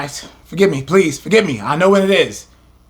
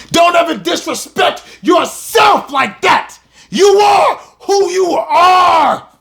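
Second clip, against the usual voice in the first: voice breaking, shouted; 8 semitones higher than usual. Real, not acted. Preacher pleading with his people, shouting as his voice breaks. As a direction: cry it out to your people as your voice breaks. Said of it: "my voice is breaking up".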